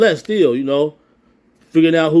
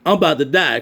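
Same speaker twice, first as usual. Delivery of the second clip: ordinary talk, nothing marked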